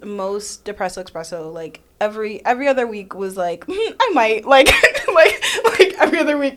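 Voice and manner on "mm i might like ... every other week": high, loud laughing joke. Real, not acted. Laughing to a friend about dark times, high.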